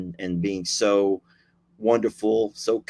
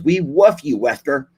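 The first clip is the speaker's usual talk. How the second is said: a light imitation of a lisp, a bit louder; lispy voice; 4 semitones higher, 6 dB louder than usual. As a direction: mimic her lispy, cute "We woof you". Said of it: "in her own lispy voice".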